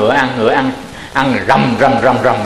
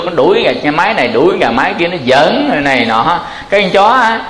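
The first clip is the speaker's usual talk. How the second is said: usual speaking voice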